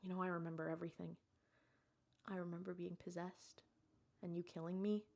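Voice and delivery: soft, subdued acted confession; muted, weak-voiced imitation; hushed confession. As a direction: confide quietly from a sickbed. Retold dying wife's confession.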